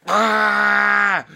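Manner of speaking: high, loud bear roar; screamed-out storybook bear roar. Acted. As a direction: let out a big long roar. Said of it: "And the bear went".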